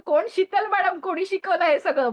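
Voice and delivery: high-pitched mock outrage; mock indignant demand. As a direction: imitate someone demanding to know who's to blame. Acts out quarrelling husbands demanding who.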